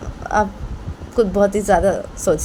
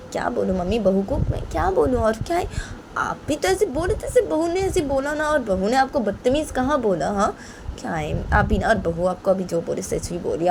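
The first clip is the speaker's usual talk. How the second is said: soft, reasoning protest; placating daughter-in-law; 5 semitones higher, 5 dB quieter than usual. Acted. Daughter-in-law defends Rahma to mother-in-law, softly placating. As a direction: reason gently with an elder, voice kept down.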